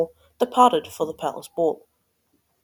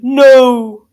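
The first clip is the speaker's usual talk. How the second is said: long, loud, raised cry; mock scream; 5 semitones higher, 12 dB louder than usual. A narrator's reading. Prince seeing the slipper fit, screaming out loud. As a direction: wail one big drawn-out no. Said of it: "The prince screamed".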